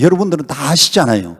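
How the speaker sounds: ordinary delivery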